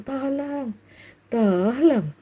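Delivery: drawn-out, languid mock plea; pleasure cry. Shown as a demonstration. Preacher shows a cry of enjoyment, languid mock pleading. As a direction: moan the call for help lazily. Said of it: "mana yang teriakan keenakan".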